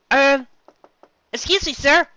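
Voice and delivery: put-on high, hesitant young mare; politely interrupting a busy barman; Twilight's timid voice. Twilight timidly calls to the bartender.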